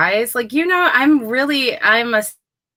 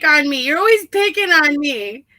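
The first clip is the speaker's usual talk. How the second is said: higher-pitched playful complaint; teased protest; 7 semitones higher than usual. Real, not acted. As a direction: protest at being picked on. Teased, protesting at host's goading.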